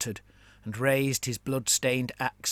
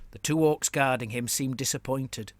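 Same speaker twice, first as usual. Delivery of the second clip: usual speaking voice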